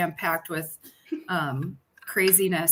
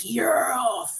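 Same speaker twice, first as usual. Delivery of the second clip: mock deep creepy voice; 2 semitones lower, much slower than usual. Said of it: "his voice got all deep".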